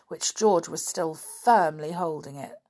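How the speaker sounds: ordinary delivery, nothing marked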